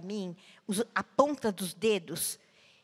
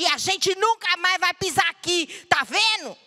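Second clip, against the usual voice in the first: defiant outcry; 7 semitones higher, 6 dB louder than usual. Retold or imitated. Crying out at church microphone.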